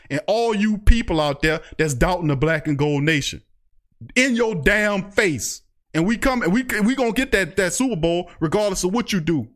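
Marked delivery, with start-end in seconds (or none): 4.1-5.6 "In your damn face" dragged out slowly, every word leaned on; slow gloating taunt. Real, not acted. Gloating at NFL doubters.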